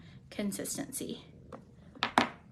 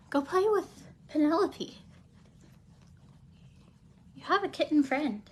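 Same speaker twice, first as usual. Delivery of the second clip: pet talk; 6 semitones higher, 4 dB louder than usual. Real, not acted. Coaxing cat away, pet talk.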